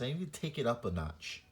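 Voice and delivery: usual speaking voice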